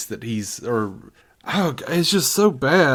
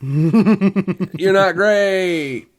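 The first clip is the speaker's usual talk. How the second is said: laughing; 5 semitones higher, 5 dB louder, much slower than usual. Real, not acted. Joking apology to the actor.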